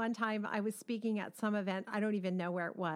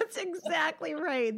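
higher, slow, laughing through "exactly"; 8 semitones higher than usual. Real, not acted. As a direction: agree while still laughing at the story. Laughing agreement after a funny story.

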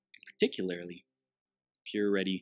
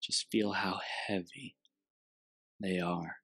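guided meditation. Acted.

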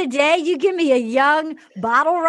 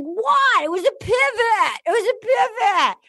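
mock Jason; 7 semitones higher than usual. Mocking Jason's excuse.

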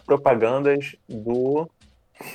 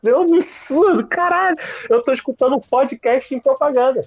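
high-pitched exasperated cry of disbelief; outraged high-pitched rant; indignant outburst; 10 semitones higher, 3 dB louder than usual. Real, not acted. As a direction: protest loudly, voice shooting way up. Indignant rant about YouTube ads.

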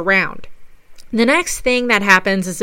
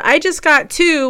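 lightly retold call, pitched up; mild raised-pitch callout; 7 semitones higher than usual. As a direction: call it out higher, without shouting. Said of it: "So I called out".

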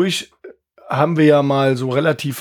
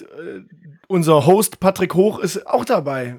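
ordinary delivery

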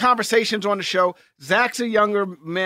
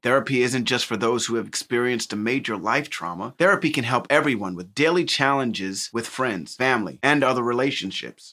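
lower, even sponsor read; 9 semitones lower than usual. Acted. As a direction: read the ad smoothly.

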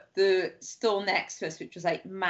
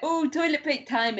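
mock excuse; 8 semitones higher than usual. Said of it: "be like".

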